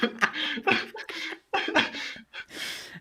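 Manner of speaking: long, high wheezing laugh; real breathless laughing fit; helpless laughter. Real, not acted. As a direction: crack up mid-sentence. Cracks up at own retort.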